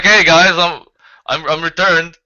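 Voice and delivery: hammed-up Jesus announcing his comeback; Jesus returning. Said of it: "He would be like".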